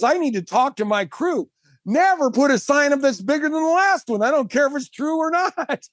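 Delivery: high-pitched comic rant at the crew; retold exasperation, voice riding high; indignant telling-off. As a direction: lay down the law, half laughing. Retold indignant orders to his crew.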